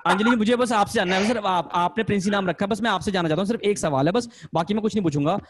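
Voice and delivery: ordinary talk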